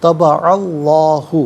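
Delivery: طبع اللہ with a full, heavy lam in اللہ; slow chanted طبع اللہ with heavy lam; heavy lam recitation. Shown as a demonstration. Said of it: "یہاں پر کر کے پڑھا جائے گا".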